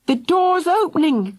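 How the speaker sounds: put-on high excited child cry. A narrator's reading. Philly cries out as the door opens. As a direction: cry out in relief. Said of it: "cried Philly".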